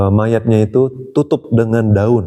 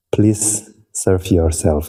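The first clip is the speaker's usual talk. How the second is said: very polite; 3 semitones lower, much slower than usual. Retold or imitated. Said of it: "dia malah sopan kali".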